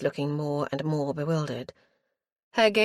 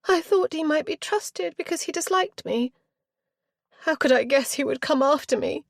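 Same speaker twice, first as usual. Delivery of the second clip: put-on petulant young girl; 11 semitones higher than usual. A narrator's reading. Spoilt girl justifying her flight to the aunt, aggrieved. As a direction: whine it out indignantly. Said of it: "eyes showed puzzlement".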